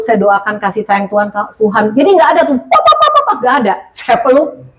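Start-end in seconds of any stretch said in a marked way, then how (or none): 2.6-3.1 high popping noises, pop pop pop; mock popping sounds. Telling the church how nothing went pop, high squeaky pops.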